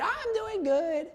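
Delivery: high, slow sing-song customer voice; lightly voiced customer on a call; mock customer. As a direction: play the sweet customer. Imitating a customer on the phone.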